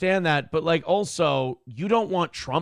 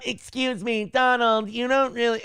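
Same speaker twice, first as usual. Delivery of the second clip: higher, mock prim interruption; mocking Kamala Harris; 7 semitones higher than usual. Mocking her interrupting Trump, higher and prim. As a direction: imitate her scolding tone, pitched up. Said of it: "that's how she talks to me".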